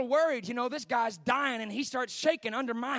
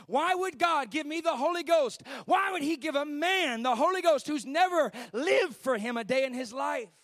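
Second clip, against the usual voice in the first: raised, loud incredulous questions; impassioned retold protest; 4 semitones higher, 5 dB louder than usual. Dying sinner protesting to the evangelist, impassioned disbelief. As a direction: plead the case against yourself.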